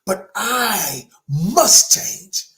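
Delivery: "must" stretched out slow and heavy; stress on must. Shown as a demonstration. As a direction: lean hard on "must", very slow. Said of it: "Not that I should change".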